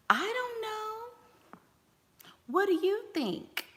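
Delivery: high, soft, very slow wavering; timid people-pleaser. Shown as a demonstration. Mother mocks someone too nice to pick a restaurant, high and meek. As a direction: dither meekly, afraid to choose. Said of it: "You're being too nice".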